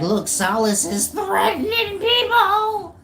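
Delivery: high, drawn-out mock whine of the viewers. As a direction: whine it back at the chat like a tattletale. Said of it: "Y'all be like".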